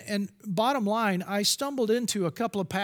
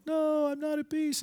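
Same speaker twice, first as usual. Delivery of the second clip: a higher, lightly voiced student admitting it; student's answer; 8 semitones higher than usual. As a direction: say it as a teen caught off guard, pitched higher.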